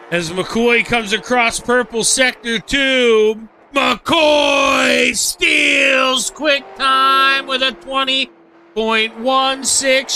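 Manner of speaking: rising, loud, thrilled play-by-play; excited commentary. Real, not acted. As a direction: call it like the crowd's on its feet. Fast lap called live.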